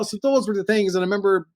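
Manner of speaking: ordinary talk, nothing marked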